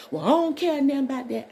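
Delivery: an angry dad refusing to listen; defiant father. Retold or imitated. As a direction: snap back like the upset father. Upset father quoted.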